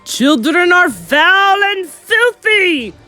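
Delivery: slow, high, very loud witch roar; drawn-out thunderous proclamation; thundered. A narrator's reading. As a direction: thunder it to the whole hall. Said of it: "thundered the Grand High Witch".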